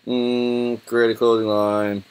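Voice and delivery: ordinary talk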